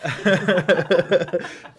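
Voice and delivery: loud, high, open laugh; real burst of laughter. Real, not acted. Guest laughs at the not-God joke, loud real laughter. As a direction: crack up hard at the joke.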